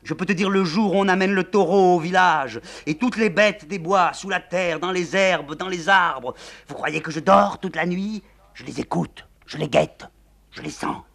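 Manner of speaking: high, loud, obsessive litany; raving madwoman. Acted. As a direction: rant it at them. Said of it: "La générale continue".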